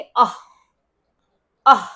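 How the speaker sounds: अह said with a breathy h release after the a; visarga sound. Shown as a demonstration. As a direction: say अह, letting the h breathe out audibly. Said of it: "कैसे बोलेंगे?".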